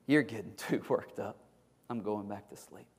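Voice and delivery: low, soft, drowsy voice with a chuckle; sleepy yawning. Acted. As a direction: brush him off, half asleep. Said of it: "Sloth kinda yawns and".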